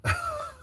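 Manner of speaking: short chuckle; brief laugh in a pause. Real, not acted. Speaker amused mid-lecture, short laugh.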